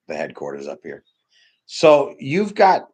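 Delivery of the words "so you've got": high, loud and very slow; slow loud build. Real, not acted. Speaker building to a rebuke of entitled mourners, loud and slow. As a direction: drag out the setup before the punch.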